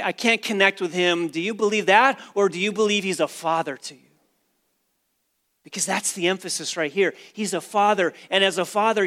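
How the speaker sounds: fervent sermon delivery building on "father"; full-force preaching. Acted. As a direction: preach it out to the back row.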